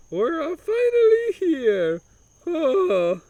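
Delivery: put-on high, slow whiny Italian accent; complaining Italian. Humdinger complaining as he reaches the window. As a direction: moan in a hammed-up Italian accent. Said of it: "he complained".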